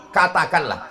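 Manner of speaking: ordinary delivery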